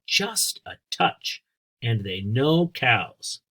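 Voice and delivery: put-on reading to children. A narrator's reading. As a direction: read it out for a class of kids. Teacher reads book to children.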